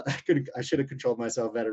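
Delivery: usual speaking voice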